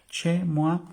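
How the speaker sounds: high emphatic. Shown as a demonstration. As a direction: lift "chez moi" high and bright. ("chez moi" agudo y enfático)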